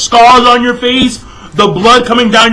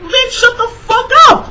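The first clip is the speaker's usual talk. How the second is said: very high, strained exasperation; high-pitched irritated rant; exasperated outburst. Real, not acted. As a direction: squeal it out, totally fed up. Fed up with crying character.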